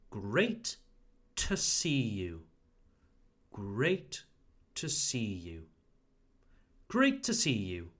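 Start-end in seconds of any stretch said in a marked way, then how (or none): 0.1-2.4 "great" rising, then "to see you" drawn out slowly. Shown as a demonstration. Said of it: "So slowly". 3.5-5.6 slowly. Shown as a demonstration. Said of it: "So slowly".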